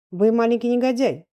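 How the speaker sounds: menacing. A narrator's reading. Stern gentleman corners a frightened pupil, menacing. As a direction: threaten the child as if baring teeth. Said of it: "таким голосом, что Тёме показалось".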